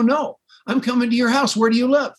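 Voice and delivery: won't take no for an answer; pushy eager. Retold or imitated. Caller insisting on coming to the man's house, pitched high and pushy. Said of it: "he knows he's got Ted Bundy on the line".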